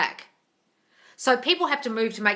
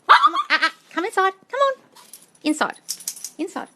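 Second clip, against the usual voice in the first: coaxing the dog; 11 semitones higher, 8 dB louder than usual. Real, not acted.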